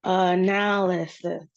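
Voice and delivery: slow "analysis" with a stretched second syllable; separated syllables. Shown as a demonstration. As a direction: draw out "analysis" to show each syllable. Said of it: "indicate the separation of the syllables".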